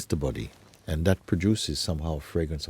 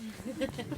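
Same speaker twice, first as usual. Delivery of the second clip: laugh; 13 dB quieter than usual. Real, not acted. Laughs at the dream's reveal.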